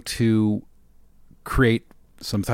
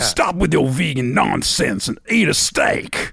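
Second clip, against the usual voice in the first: wrestling promo threat; 4 semitones higher, 4 dB louder than usual. Pretend. Host as a wrestler calling out a vegan rival, mock Southern accent. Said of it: "threaten their opponent".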